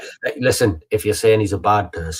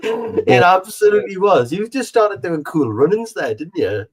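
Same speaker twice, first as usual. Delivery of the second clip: teasing; 11 semitones higher, 6 dB louder than usual. Real, not acted. Teasing co-host, laughing.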